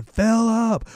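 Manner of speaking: high-pitched mock yell, no real volume; mock scream. Will calling up to sleepwalking Philip, high mock scream. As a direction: imitate screaming out a name, high and held back. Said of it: "starts screaming".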